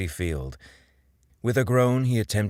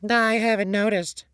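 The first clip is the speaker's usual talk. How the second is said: high, offhand SpongeBob voice; breezy and careless, higher than the narrator; nonchalant; 4 dB louder than usual. A narrator's reading. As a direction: toss it off like it's nothing. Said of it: "SpongeBob said nonchalantly".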